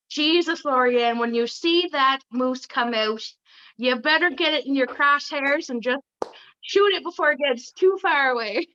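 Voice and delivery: game attempt at a Newfoundland accent. Guest put on the spot as a moose-hunt guide, Newfoundland accent. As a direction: put on a Newfie lilt that slips toward Irish. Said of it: "Can you do a Newfoundland accent?".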